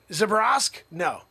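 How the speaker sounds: normal talking voice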